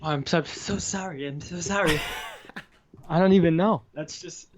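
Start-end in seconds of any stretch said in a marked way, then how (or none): 0.0-2.0 grovel sheepishly to the angry wife; Tony Khan apology. Retold or imitated. Tony Khan apologizing to the wife as she walks in, sheepish. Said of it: "You sound more like Tony Khan".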